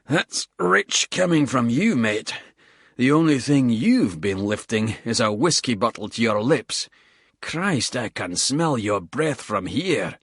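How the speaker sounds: a tired squaddie cursing the climb between breaths; lightly voiced Scottish soldier, puffing; Bald, winded Scottish soldier. Bald firing back at Porter.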